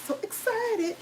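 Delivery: high squeaky "So excited" with breathy softness; squealed excitement. Real, not acted. Host squealing to her livestream viewers, over the top excited. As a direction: squeal it like you can't contain yourself. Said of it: "I know I'm extra".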